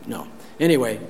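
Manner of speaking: ordinary delivery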